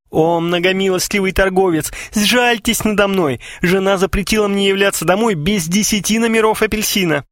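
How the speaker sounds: high-pitched theatrical begging; pleading customer. Acted. Customer begs shop clerk for magazines, pleading. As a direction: plead with mock reverence.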